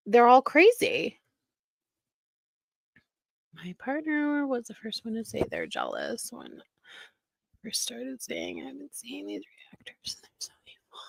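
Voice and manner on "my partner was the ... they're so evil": whispered read of a quoted comment; mock-whispered quote. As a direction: breathe it out mockingly, barely voiced.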